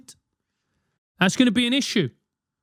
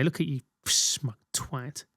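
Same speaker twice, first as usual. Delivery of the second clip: low, softer seething mutter; muttered insult; 4 semitones lower, 8 dB quieter, slower than usual. Retold or imitated. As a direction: spit it out quietly through the teeth. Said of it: "You're just there".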